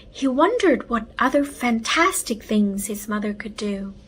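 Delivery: ordinary delivery, nothing marked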